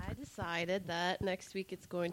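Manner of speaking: off-mic, distant voice; speaking away from the microphone; turned away from mic. Real, not acted. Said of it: "I need you to talk into the microphone from now on".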